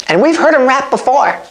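ordinary delivery

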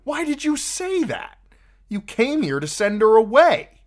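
high, loud scolding yell; screaming. A narrator's reading. Tyrion's inner voice screaming at him, high and loud. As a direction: berate yourself at the top of your voice. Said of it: "the voice inside him screamed".

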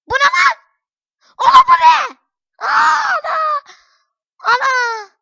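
very high, full-volume shrieks; panicked screaming. Real, not acted. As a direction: shriek in shock at a game disaster. Screams as the game goes wrong. (tiz, avazı çıktığı kadar çığlık)

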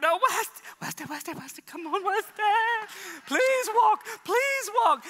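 high, loud desperate begging; desperate pleading. Retold or imitated. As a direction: beg the boy to get up and walk. Father begs child to walk.

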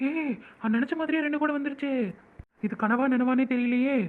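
joyful surprise. Acted. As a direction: burst out with disbelieving joy. Sees two lines on test. (மகிழ்ச்சி ஆச்சரியம்)

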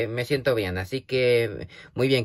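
ordinary talk

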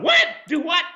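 high disbelieving yelp of a shocked viewer; mock outrage, voice jumping up. Viewers react to beating a donkey, high shocked disbelief. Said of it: "people going".

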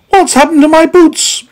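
high, loud dismayed question; astonished. A narrator's reading. Meddle discovering his boots are gone, astonished. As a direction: cry it out in high alarm.

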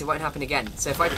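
ordinary delivery

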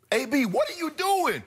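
high, incredulous mock scold; imitating an exasperated PR man; exasperated handler. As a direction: imitate a handler throwing up his hands. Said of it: "Let me go".

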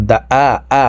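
দা আ with a plain glottal hamza instead of the throat ain; wrong ain as hamza. Shown as a demonstration. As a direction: show the common mistake, আ said like hamza. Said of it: "এইভাবে পড়ে থাকি". (হামজার মতো ভুল আইন)

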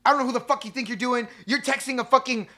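high, loud furious mom threatening a stranger; angry mother yelling. Retold or imitated. Outraged mother yelling at a stranger texting her daughter, loud and high. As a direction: yell it like an outraged mother accusing a pervert. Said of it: "And she's like".

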